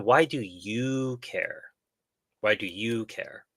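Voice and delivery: slow, gentle genuine question; curious tone. Shown as a demonstration. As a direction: ask it with real curiosity. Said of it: "rephrase it so the tonality sounds right".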